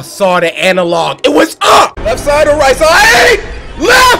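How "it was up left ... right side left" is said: panicked high shouts; being chased. Real, not acted. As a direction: scream the directions in terror. Fleeing granny again.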